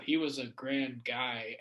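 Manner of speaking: low, soft, slow monotone; imitating Larry King, low and soft. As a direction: drone it like an old host. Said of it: "that weird monotone voice that he does".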